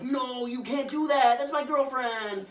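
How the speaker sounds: mock upset protest. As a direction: plead with your friend, hammed up. Morbius protesting to Neil.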